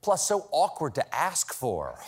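normal talking voice

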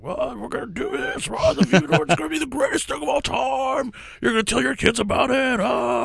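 very high, hammed-up hype speech; shrieky mock rallying cry, sustained way up high; imitating absent co-host's rant. Imagining Chris standing up over music, mock rousing shout. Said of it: "he'd get up and stand up and he'd be like".

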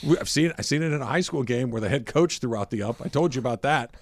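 usual speaking voice

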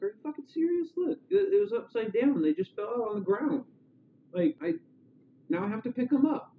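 high-pitched, indignant disbelief; put-upon, high-voiced complaint; exasperated retold outburst. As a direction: squeal it in mock exasperation. Retells heroine's irritated outburst in story.

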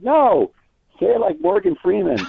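urging a star to do his famous voice, high and excited; excited plea. Retold or imitated. Interviewer begging Morgan to do his voice, excitedly high.

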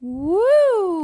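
loud high whoop; cheering whoop. Real, not acted. Cheering after Yankees talk.

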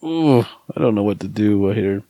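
ordinary talk

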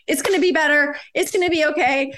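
eager reassurance. Retold or imitated. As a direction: rush to promise it'll be okay.

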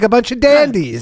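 high, dandyish Irish voice; hammed-up high Irish lilt; mock Irish accent. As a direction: imitate the Irish as dandies, pitched high. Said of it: "what everybody in Ireland sounds like".

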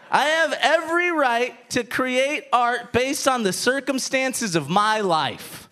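usual speaking voice

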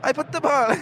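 put-on high, muddled Italian-Welsh accent; hammed-up Italian sliding into Welsh. Co-host mocks the impression, Italian Welsh accent. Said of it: "an Italian Welsh accent".

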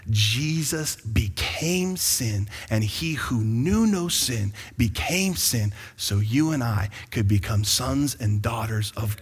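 Jesus became sin, drawn out very slowly; slow emphatic. Acted. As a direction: spell out each word. Slow emphatic key point.

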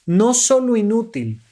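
ordinary delivery

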